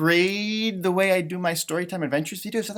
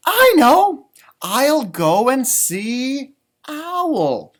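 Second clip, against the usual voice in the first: high, loud, slow bright rabbit voice; sudden happy idea, drawn out; Rabbit's idea; 7 semitones higher, 7 dB louder, much slower than usual. Acted. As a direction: Rabbit deciding to visit Owl, delighted. Rabbit speaks in story.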